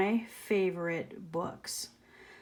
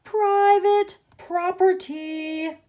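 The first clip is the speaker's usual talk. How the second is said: loud, higher, slow yell; 10 semitones higher, 7 dB louder, slower than usual. Acted. Grumpy cactus yelling at the tortoise, loud and slow. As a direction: bellow it to drive them off. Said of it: "yelled Hank".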